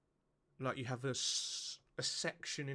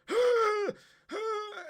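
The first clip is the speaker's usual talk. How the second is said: high, loud mock straining grunts; straining groans; 10 dB louder than usual. A man on the toilet at 4am, mock straining groans. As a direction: groan like you're stuck on the toilet. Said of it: "at four o'clock in the morning going".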